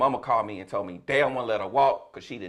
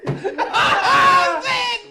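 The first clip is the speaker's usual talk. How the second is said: a big burst of laughter; laughing shout; 9 dB louder than usual. Real, not acted. Comedian cracking up while telling the story, laughing loud. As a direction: crack up loudly.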